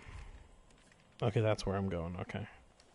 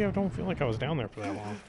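ordinary delivery

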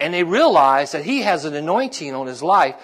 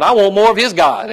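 loud, eager imitated outburst; eager listener; 4 semitones higher, 7 dB louder than usual. Hearers react to bragging on God, eager. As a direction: voice an excited convert, punching it louder.

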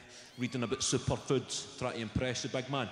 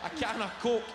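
higher, rapid-fire list of junk food; excited rant; 6 semitones higher than usual. Retold or imitated. Comedian to audience, gleefully listing childhood junk food. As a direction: rattle off the junk food with mounting glee.

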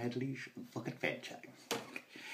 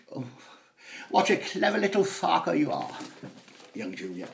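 lightly voiced plummy praise; mild mock-aristocratic dad; patronising posh father; 3 semitones higher, 6 dB louder, faster than usual. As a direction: pat the boy on the head with words.

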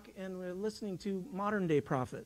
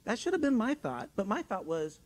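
ordinary talk, nothing marked

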